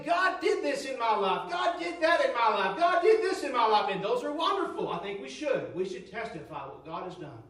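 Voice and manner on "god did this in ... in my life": lightly voiced eager testimony, a bit louder; mocking testimony. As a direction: parody the churchgoer's proud testimony with a laugh. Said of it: "and say".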